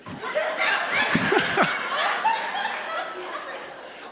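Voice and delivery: long high laugh; real laugh he can't hold back. Real, not acted. Laughing at own joke.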